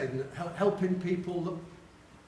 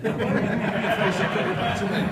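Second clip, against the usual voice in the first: laughing; 3 semitones higher, 7 dB louder than usual. Real, not acted. Teller laughing at the story.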